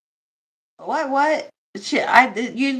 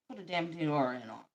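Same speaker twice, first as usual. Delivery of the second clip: muttered aside; 6 semitones lower, 12 dB quieter, faster than usual. Shown as a demonstration. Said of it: "repeated quieter".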